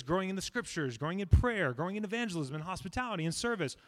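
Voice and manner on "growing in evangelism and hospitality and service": ordinary talk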